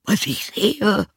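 very high, slow donkey voice; donkey character. Acted. Donkey at the window answers the rooster, high donkey voice. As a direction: draw it out high.

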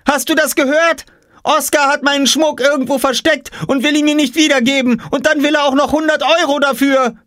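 put-on very high outraged girl voice; outraged telling. A narrator's reading. Jule telling on Oskar to Mama, outraged. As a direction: tell on him furiously.

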